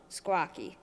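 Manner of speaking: ordinary delivery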